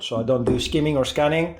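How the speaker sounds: ordinary talk, nothing marked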